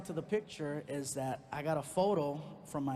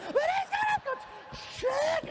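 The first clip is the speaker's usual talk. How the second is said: put-on wailing girlfriend; 5 dB louder than usual.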